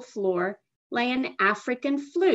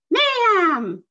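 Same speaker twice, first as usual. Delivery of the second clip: high, loud trumpet cry; trumpeted crane call; 10 semitones higher, 7 dB louder than usual. A narrator's reading. Crane trumpets its call to the girl, high and loud. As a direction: blast it like a royal crane. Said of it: "trumpeted the royal bird".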